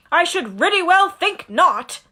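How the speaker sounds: a hint of a stern giant; growling Hagrid. Hagrid scolding the boys, mock gruff. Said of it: "growled Hagrid".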